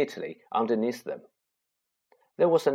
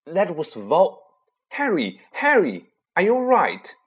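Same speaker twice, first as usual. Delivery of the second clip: shaken; 8 semitones higher, 6 dB louder than usual. Acted. Shaken Harry, then Hermione calling.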